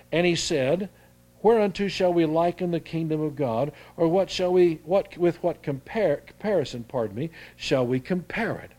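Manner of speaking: King James reading voice; scripture reading. Acted. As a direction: read the verse out to the church. Reads scripture aloud.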